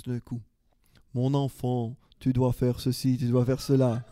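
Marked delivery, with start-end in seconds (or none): 1.2-4.0 a lightly done, lower mock accent of France French, breaking into a laugh at the end. Pastor mimics God speaking in a half-hearted France French accent. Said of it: "J'arrive pas à faire l'accent français".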